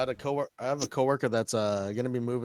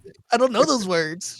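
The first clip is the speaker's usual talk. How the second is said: mock shout; 10 semitones higher, 11 dB louder than usual. Mock refusing to do impression.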